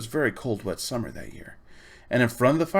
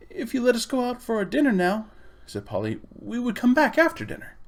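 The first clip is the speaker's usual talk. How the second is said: put-on high, eager girl's offer; hopeful girl; 11 semitones higher than usual. A narrator's reading. Polly offers to come back after dinner, high eager girl's voice. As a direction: bargain sweetly to get out.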